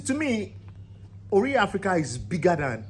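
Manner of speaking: usual speaking voice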